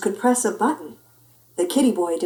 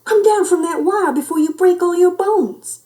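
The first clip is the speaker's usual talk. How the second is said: high-pitched worried mother's wail; anxious, high scolding cry; wailing mama; 10 semitones higher, 6 dB louder than usual. A narrator's reading. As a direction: call up in alarm, pitched well above normal. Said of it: "wailed Mama Junebug Jones".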